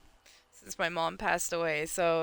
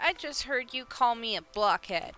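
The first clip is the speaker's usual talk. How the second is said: higher, indignant villager accusing the player; angry villager voice; 6 semitones higher than usual. Acted. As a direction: confront the player like an offended neighbour. Voicing an angry game villager.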